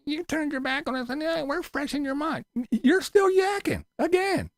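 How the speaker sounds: very high, soft mocking taunt; mock whiny voice. Mocking critic's attention.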